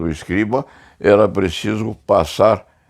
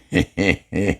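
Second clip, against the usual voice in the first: chuckle. Real, not acted. Storyteller amused by his own anecdote about soldiers, chuckling. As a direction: chuckle to yourself mid-story.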